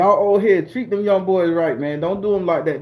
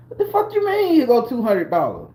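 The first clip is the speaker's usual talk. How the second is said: much higher, outraged echoing her words; indignant disbelief; 6 semitones higher than usual. Retold or imitated. Indignant retort to her offer.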